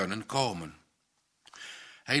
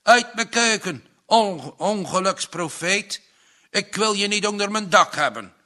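loud, higher raging yell, rushed in spots; furious shout; 6 semitones higher, 7 dB louder than usual. A narrator's reading. Furious farmer throwing Tinus out of his kitchen. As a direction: throw him out with fury. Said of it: "schreeuwde hij".

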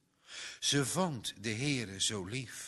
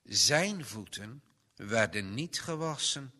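ordinary delivery, nothing marked

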